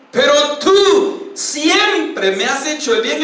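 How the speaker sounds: más alto y lento, con voz dolida; lamento del rey. Acted. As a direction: reconoce la bondad con pena. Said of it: "y empieza a llorar a gritos". (Saúl reconoce la bondad de David, dolido)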